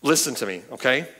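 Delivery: ordinary talk, nothing marked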